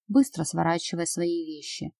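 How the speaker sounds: ordinary delivery, nothing marked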